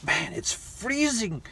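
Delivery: high, slow, hammed-up whine about the cold; mock shivering complaint. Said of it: "and I'm like".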